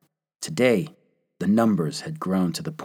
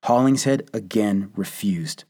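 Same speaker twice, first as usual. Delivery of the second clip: plain reading voice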